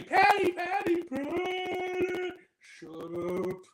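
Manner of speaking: mock the cat character's nagging; imitating the cartoon cat calling his daughter; whiny cat dad. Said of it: "And then he's like".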